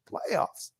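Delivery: breathy whisper of the word; whispered playoffs. Pretend. Cohost whispering playoffs to mock the team. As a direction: hiss it out under breath. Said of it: "See how smooth?".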